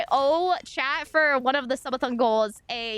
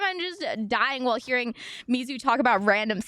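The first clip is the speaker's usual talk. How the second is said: usual speaking voice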